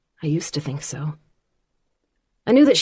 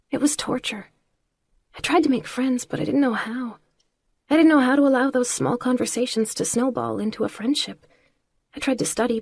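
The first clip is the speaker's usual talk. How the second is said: put-on young girl voice; 5 semitones higher than usual. A narrator's reading. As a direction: tell it like a hurt young girl. Lana confides her struggles.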